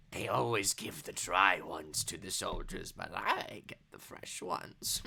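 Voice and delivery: low, soft granny voice, slowing down; confiding granny. Acted. Granny confides in her guest about steaks, hushed.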